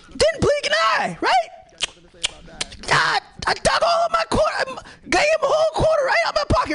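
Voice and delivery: squeaky high-pitched shouting storyteller; high frantic yelping voice; high excited yelling. Retold or imitated. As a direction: shriek it out in a high cartoonish voice. Comic acts out giving quarter, high shouting.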